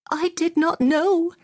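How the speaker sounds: slow, very high-pitched woman's protest; anguished cry. A narrator's reading. Mem Sahib answering the boy officer about her servants, anguished cry. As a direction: cry out in dismay, drawn out and high. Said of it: "the Mem Sahib cried".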